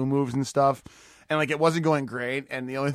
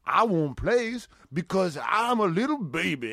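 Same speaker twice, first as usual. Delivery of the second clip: slow, higher put-on character voice; mock ballplayer; 6 semitones higher, slower than usual. Sketch ballplayer answering schoolchildren, slow mock character voice. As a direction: drag it out in a higher mocking voice. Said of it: "And he's like".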